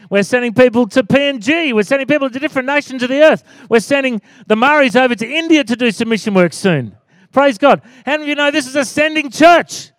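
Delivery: rally the congregation with rising pitch; full-force preaching. Real, not acted. Pastor rallying his church about sending missions, fervent preaching.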